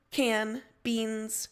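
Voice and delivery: ordinary delivery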